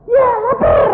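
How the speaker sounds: put-on high, loud childish yell; like a small child. Husband demanding food from nurse, childlike shout. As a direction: insist like a hungry kid, hammed-up. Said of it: "Kayak anak kecil nggak?".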